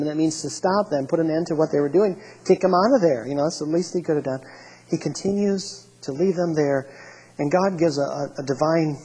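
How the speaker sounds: normal talking voice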